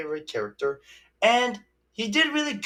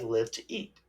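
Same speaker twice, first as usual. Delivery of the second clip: ordinary talk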